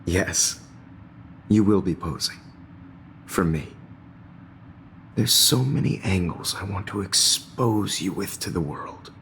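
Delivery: sinister voice. Acted. Nightmare teacher threatens Max.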